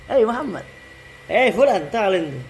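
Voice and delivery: calling out. Shown as a demonstration. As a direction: hail a guy across the yard.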